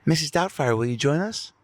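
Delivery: high, lilting actress impression; put-on high, sing-song voice; Sally Field. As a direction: pitch it way up as a polite invitation. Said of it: "Here's another Sally Field ready".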